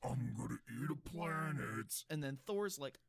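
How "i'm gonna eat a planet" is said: big bad spooky guy. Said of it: "big bad spooky guy goes".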